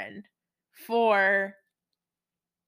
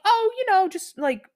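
breezy evasion; 9 semitones higher, 4 dB louder than usual. Acted. Acted evasive answer about plans.

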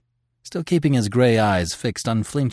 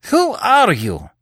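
higher, louder demand; Terry bursting out at his captors, high and loud; 13 semitones higher, 5 dB louder, slower than usual. Acted. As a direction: blurt the question out. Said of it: "burst forth Terry".

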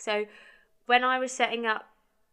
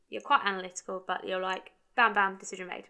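usual speaking voice

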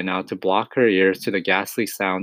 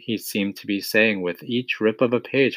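plain reading voice

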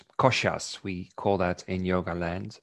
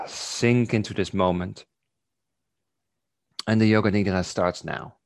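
guided meditation. Acted. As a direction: lull them gently into stillness. Leads a body awareness meditation.

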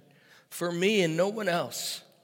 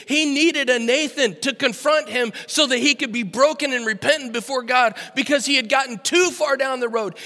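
loud, higher impassioned sermon; full-force preaching; 9 semitones higher, 10 dB louder than usual. Acted. Pastor preaching on Nathan confronting David, impassioned. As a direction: thunder it at the congregation.

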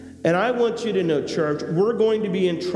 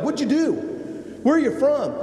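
alarmed questions; 12 semitones higher than usual. Retold or imitated. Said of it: "they're like".

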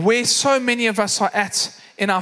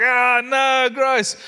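higher-pitched mock disgust; really grossed out; 4 semitones higher, 4 dB louder, much slower than usual. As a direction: imitate kids drawing out their revulsion. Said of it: "really grossed out".

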